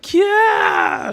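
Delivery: very high, loud "kya" scream; yelled shriek. A narrator's reading. Gerd falls back with hurt hands, shrieking. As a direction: shriek it out in pain. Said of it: "she yells".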